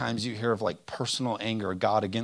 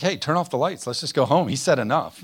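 mock giving up; 4 semitones higher, 7 dB louder than usual.

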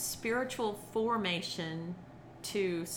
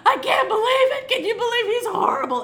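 high, loud laughing mock sob; mock wailing; 13 semitones higher, 15 dB louder than usual. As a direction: ham up her outrage. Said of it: "acted just like she acted".

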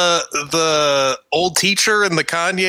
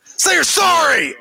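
mock bossy daycare lady; 11 semitones higher than usual. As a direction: bark the apology demand. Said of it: "echoing the daycare woman".

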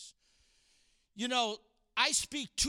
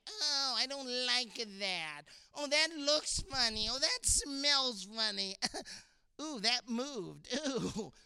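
mock picky eater voice; whiny complaining kid. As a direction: whine about the food. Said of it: "you begin to complain".

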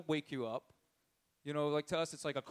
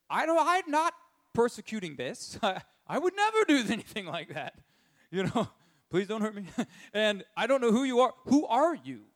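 higher, louder, half-laughing plea; hammed-up scared Paul pleading not to be hurt; mock frightened pleading; 6 semitones higher, 7 dB louder than usual. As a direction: beg for mercy with a laugh in the voice. Paul pleading before Jesus, laughing.

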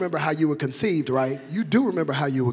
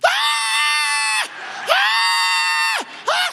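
put-on very high, loud baby scream; newborn's cry; 9 dB louder, much slower than usual. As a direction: imitate a wailing newborn at full lungs. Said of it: "comes into the world just like this".

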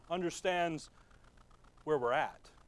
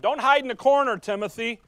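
higher and louder, urging; urgent call; 6 semitones higher, 10 dB louder than usual. Retold or imitated. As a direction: call Timothy out of hiding. Paul urging Timothy.

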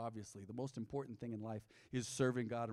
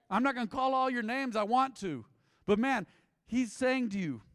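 ordinary talk

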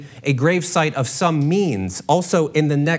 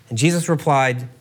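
ordinary talk, nothing marked

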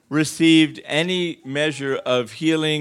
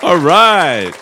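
normal talking voice

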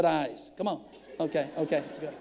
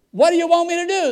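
high, loud, fast protest; exasperated; 9 semitones higher, 7 dB louder, faster than usual. Retold or imitated. Pastor asks negotiator what he wants, exasperated. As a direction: fire it off in raised-voice bewilderment.

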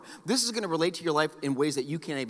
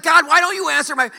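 high, loud complaint to God; exasperated plea; 10 semitones higher, 9 dB louder than usual. Retold or imitated. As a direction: whine at God for not answering. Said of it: "I'm like".

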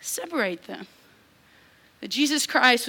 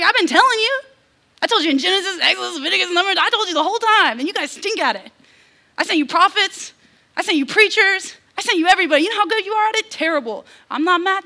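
high, loud scolding God; hammed-up divine scolding, pitched up and loud; exasperated God; 7 semitones higher, 6 dB louder, faster than usual. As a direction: preach it as a fed-up father listing failures. God voiced scolding his people.

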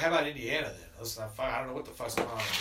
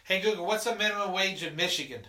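raised voice to a smart speaker; loud command; 6 semitones higher, 5 dB louder than usual. Real, not acted. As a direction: call out clearly to the device.

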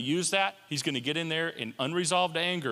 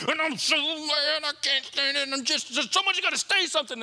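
worked-up outrage; 9 semitones higher than usual. Acted. Angry protester voiced in sermon.

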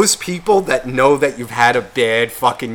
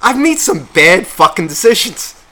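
said louder, stress on made; louder emphatic confession; 5 semitones higher, 5 dB louder than usual. Real, not acted. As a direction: declare it louder, rising. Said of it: "I'm actually realizing".

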